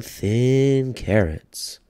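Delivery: plain reading voice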